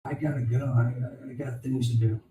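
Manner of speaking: soft, under-the-breath goodbye; hushed excuse. Retold or imitated. Recruit to the kid, heads down, hushed excuse. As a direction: murmur it so nobody in charge hears. Secretly taking leave, heads down.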